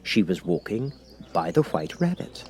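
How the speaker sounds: ordinary delivery, nothing marked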